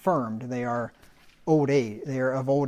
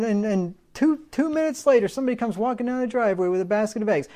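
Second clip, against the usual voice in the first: higher-pitched mock boaster; boastful answered-prayer believer; 7 semitones higher than usual. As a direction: imitate someone bragging about instantly answered prayers. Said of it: "Beware of people who tell you that".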